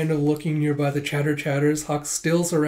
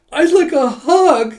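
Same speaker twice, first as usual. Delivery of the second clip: much higher and louder, slow and swooning; squealy admirer; 14 semitones higher, 11 dB louder, slower than usual. Acted. A smitten customer asking Yun for a hug, squealy high voice. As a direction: gush it like a smitten fangirl.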